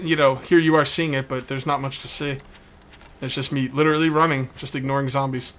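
ordinary talk

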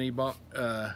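talking around pipe stem; pipe in mouth. Real, not acted. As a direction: teeth clenched on pipe. Seen 0: Pipe stem clenched between teeth from about 1.3s, through the phrase.

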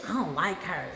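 a hint of a catty girl, low and soft. Jealous girls at the club eyeing the newcomer, muttered softly. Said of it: "I would have girls right off the rip".

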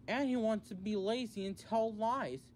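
high-pitched scowling jab; scowling accusation. A narrator's reading. Toby backs up Percy against Boko, scowling. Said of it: "Toby scowled".

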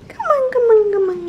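high, quick come-on to the dog; high coaxing repeated call. Real, not acted. Calling the puppy repeatedly.

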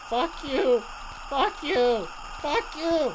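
high, loud repeated yell; furious high shouts; angry shouting. Real, not acted. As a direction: scream the curse at the enemy. Curses the enemy that caught him.

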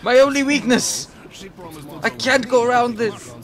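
high, loud melodramatic wail of defeat; mock despair. Gamer bemoans an obstacle that blocks the way, mock despair.

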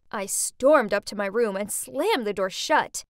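ordinary delivery

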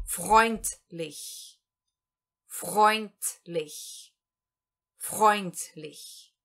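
freundlich with oi sound and final t before lich; German word freundlich. Shown as a demonstration. Teacher repeats freundlich after explaining the sounds, slow and clear. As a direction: say freundlich slowly, stressing Freund. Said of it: "tudo isso vai ser pronunciado".

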